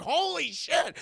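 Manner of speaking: high-pitched hammed-up astonishment; theatrical stunned cry; mock shock. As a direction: exclaim in exaggerated disbelief. Acted shock at the killing.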